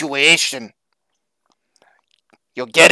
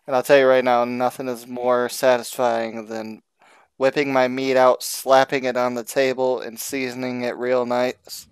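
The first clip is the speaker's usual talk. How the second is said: sultry ad read; 4 semitones lower, 8 dB quieter than usual. Acted. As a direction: purr it like a cheeky ad. Ad read, lowered suggestive.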